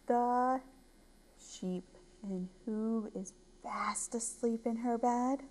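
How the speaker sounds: soft, near-whispered narration; hushed bedtime ending. A narrator's reading. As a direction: wind down quietly with long pauses. Hushed ending, Ava falls asleep.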